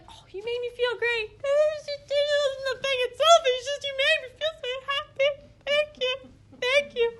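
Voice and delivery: high, loud gushing gratitude; gushing thanks. Retold or imitated. Teacher imitates how women thank, gushing high and loud. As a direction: gush it like you're overwhelmed with happiness. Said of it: "That's such a woman response".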